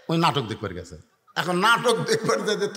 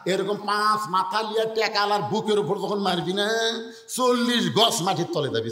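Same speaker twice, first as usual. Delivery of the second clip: ordinary talk, nothing marked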